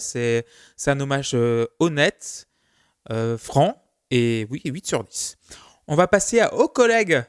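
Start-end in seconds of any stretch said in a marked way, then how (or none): 5.9-7.2 put-on quick burst of southern French accent. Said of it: "je prends un accent du Sud".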